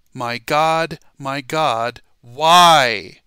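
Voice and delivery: loud preacher's cry, swelling on "why"; shouted cry. Acted. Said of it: "And he shouted it. He said it with a loud voice".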